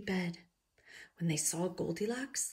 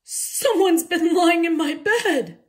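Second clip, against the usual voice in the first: loud bear voice; 10 semitones higher, 13 dB louder, slower than usual. A narrator's reading. Papa Bear outraged.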